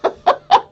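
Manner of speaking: laugh. Real, not acted. Laughing while retelling.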